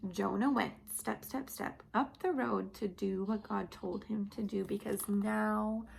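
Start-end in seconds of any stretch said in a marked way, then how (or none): none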